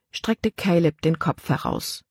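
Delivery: ordinary delivery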